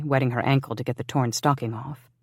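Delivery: plain reading voice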